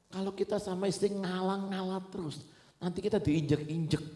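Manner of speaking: animated retold caution; acted-out warning. Acting out someone warning him.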